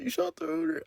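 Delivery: put-on very high falsetto girlfriend voice; mock girlfriend squeal. Maya voiced again.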